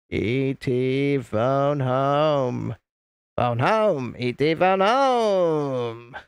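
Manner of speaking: very slow, drawn-out alien voice; hammed-up alien, long drawn vowels. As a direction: stretch each word like a little film alien. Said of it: "He really does look like E T".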